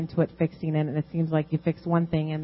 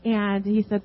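ordinary talk, nothing marked

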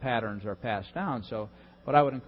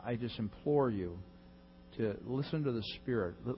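ordinary talk

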